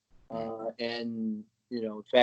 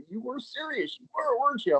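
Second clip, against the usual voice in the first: surprised; 10 semitones higher than usual. Retold or imitated. Jenny realizing he was virgin.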